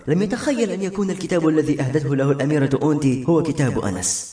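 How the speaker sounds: plain reading voice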